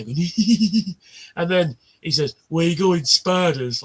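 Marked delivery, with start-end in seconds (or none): 0.2-0.9 high, louder mock giggle; tee-hee like kids at the back; imitated giggling. Man imitating kids giggling away on the bus, mock giggle. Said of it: "kids in the back giggling away". 2.5-3.8 raised, lightly imitated jeer; retold heckle. Man retelling a kid's bus heckle, lightly imitated taunt. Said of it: "Like that".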